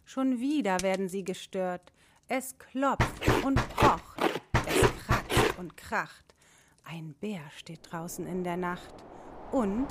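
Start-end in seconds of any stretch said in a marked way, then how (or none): 2.3-6.3 slow, loud, pounding narration; dramatic knocking. A narrator's reading. Narrator builds suspense for children, slow and loud. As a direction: hammer out each word like blows on the door.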